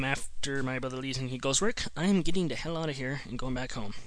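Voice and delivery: ordinary talk, nothing marked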